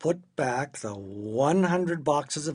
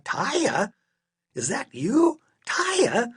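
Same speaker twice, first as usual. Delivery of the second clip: high, rising disbelief; puzzled double take, pitched way up; astonished questioning; 10 semitones higher, 4 dB louder, much slower than usual. A narrator's reading. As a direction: squeak it out as a baffled question. Father spots her on shelf.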